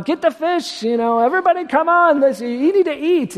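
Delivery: hammed-up Yiddish mama fussing; Yiddish mother. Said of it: "she's like".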